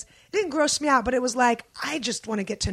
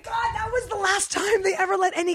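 excited recognition; 7 semitones higher than usual. Real, not acted. Friend suddenly remembering their press day together, excited outburst. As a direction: cry out as the memory hits you.

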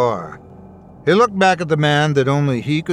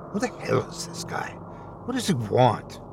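whispered thoughts; 7 dB quieter than usual. Acted. Corey's frightened inner thoughts.

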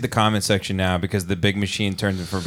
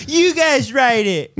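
very high, slow, dopey voice; high and dragged, like a dim singer; dopey pop star voice; 3 dB louder, slower than usual. Pretend. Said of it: "He's like".

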